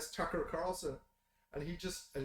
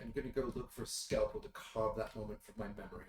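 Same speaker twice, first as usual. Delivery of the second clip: lower, softer, haughty revulsion; prim disgusted lady; 7 semitones lower, 4 dB quieter than usual. Acted. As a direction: sniff it out like an offended society lady. Prim friends voiced disgusted.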